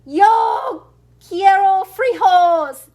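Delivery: hammed-up grave proclamation, slow and loud; somber declaration. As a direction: declare it slowly like a solemn decree. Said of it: "in his most somber voice declared".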